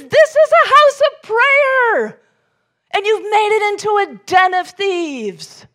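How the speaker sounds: Jesus overturning the market, roaring rebuke; furious rebuke. Retold or imitated. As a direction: thunder it at the temple traders. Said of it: "he's mad".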